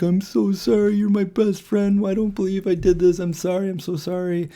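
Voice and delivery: high, pleading mock sobbing apology; imitating crying friend. Boy crying to his best friend, high tearful mock apology. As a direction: whimper it out like a crying kid begging forgiveness. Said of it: "kneeled down and started crying".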